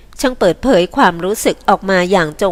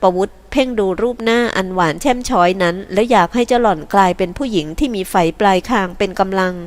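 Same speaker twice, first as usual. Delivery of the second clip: usual speaking voice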